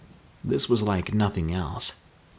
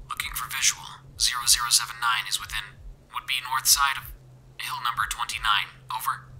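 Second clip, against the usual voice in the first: quick, softer, regimented radio report; military radio voice; 3 semitones higher, 5 dB quieter, faster than usual. A narrator's reading. Soldier radios a position to his unit, military and regimented. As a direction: read coordinates over a faint radio. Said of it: "tone of the man's voice as military".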